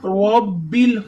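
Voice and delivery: normal talking voice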